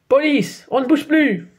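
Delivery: loud, mock gruff policeman; hammed-up police command; big voice. A narrator's reading. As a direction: bark the order like a cop. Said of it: "fait une grosse voix".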